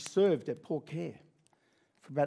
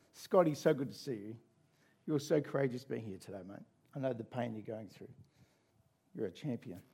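soft consoling voice; 6 semitones lower, 6 dB quieter, faster than usual. Acted. Pastor voicing a consoling greeter.

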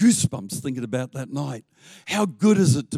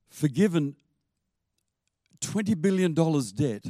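ordinary delivery

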